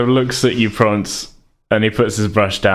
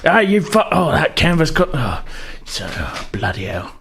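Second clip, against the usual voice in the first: grumbling painter; 4 semitones higher than usual. Acted. Painter cut by canvas.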